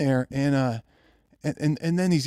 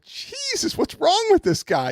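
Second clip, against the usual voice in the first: incredulous; slower than usual. Retold or imitated. Reacting to kid's story.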